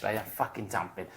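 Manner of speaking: ordinary talk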